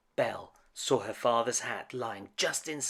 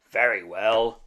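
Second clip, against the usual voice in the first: louder gruff beast; louder beastly assent; 9 dB louder than usual. A narrator's reading. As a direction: grant it grudgingly as the beast. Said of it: "said the beast".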